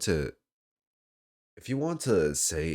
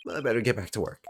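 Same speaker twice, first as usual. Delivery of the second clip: slightly hammed New York sign-off, higher; bad New York accent; 7 semitones higher, 4 dB louder, much faster than usual. Caretaker slips away back to work, bad New York accent. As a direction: excuse yourself in a put-on New York accent. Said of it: "my bad New York accent".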